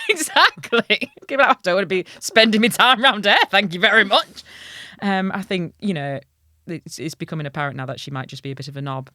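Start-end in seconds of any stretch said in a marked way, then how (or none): 0.1-4.4 snooty princess. Retold or imitated. Mocking the princess, laughing.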